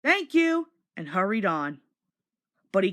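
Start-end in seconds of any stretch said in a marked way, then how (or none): 0.0-0.7 bright thank you. Acted. Thomas answers waving men.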